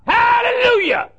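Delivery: jumping up and hollering praise; excited shout. Retold or imitated. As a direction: cry out in church joy. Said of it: "he jumped up and said".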